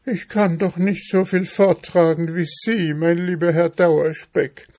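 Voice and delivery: high-pitched, pleading little mouse; poor hungry mouse, pitched high; plaintive mouse. Acted. Mouse answers in the story.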